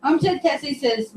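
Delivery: usual speaking voice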